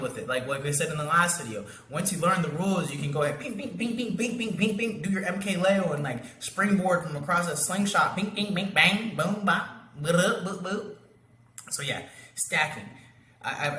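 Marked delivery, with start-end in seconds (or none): 3.4-5.0 high-pitched run of pinball bings; ping the word out like a bouncing ball; rapid bouncing pings. Shown as a demonstration. Coach shows jumping around the framework, bouncy sound effects. 8.1-11.0 high pings bursting into loud booms; ricochet sound effects. Shown as a demonstration. Coach illustrates springboarding off a slingshot, cartoon sound effects. More imitated ricochet noises.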